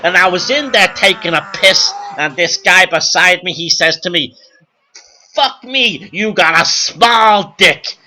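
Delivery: loud, hammed-up club comic working the crowd; brash stand-up comedian. Joey Schmuck playing the Copacabana crowd, brash comic patter. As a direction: belt it out like a hack nightclub act.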